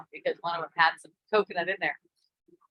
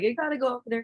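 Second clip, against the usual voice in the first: urgent summons; 6 semitones higher, much faster than usual. Retold or imitated. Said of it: "it was like that kind of vibe".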